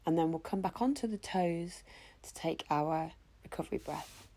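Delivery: usual speaking voice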